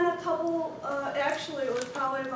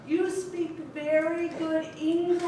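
slow patronizing; slower than usual. Retold or imitated. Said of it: "She's talking very slow and very clear".

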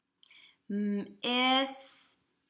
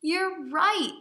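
a bright "You're right" pitched up high; cheerful dad; 9 semitones higher, 6 dB louder than usual. Acted. Dad voiced in story.

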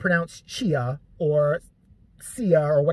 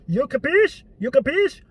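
mock Italian dad, pitched up and punchy; a hint of a wannabe Italian father; dad's Italian phrase; 8 semitones higher, 4 dB louder than usual. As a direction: ham up a fake Italian tough guy.

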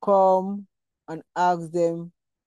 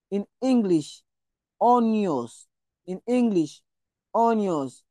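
normal talking voice

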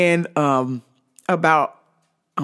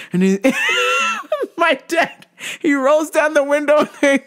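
usual speaking voice

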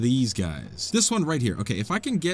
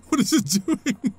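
higher, laughing disbelief; 11 semitones higher than usual. Real, not acted. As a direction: laugh through the question in disbelief. Laughs at the odd puzzle.

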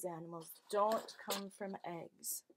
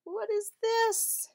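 much higher and louder, slow; surprised wonder; 11 semitones higher, 6 dB louder, slower than usual. Acted. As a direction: ask in wide-eyed wonder. Reveal behind pink door.

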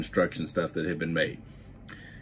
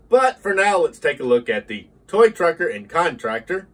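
higher, louder narrator read; documentary narration; 5 semitones higher, 5 dB louder than usual. Acted. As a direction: narrate the history, then pitch the model. Narration then promo read.

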